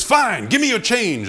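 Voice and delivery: high-pitched acted robber's demand; mock exasperated hold-up order; exasperated mugger. Robber settles for change, acted.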